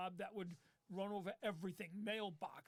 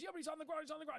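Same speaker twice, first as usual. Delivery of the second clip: panicked; 7 semitones higher, much faster than usual. Retold or imitated. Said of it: "He goes".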